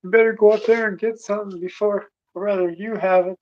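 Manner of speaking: high, breathless imitation of a woman rushing out with news; excited woman. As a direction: mimic her hurried, excited gush. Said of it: "She's like".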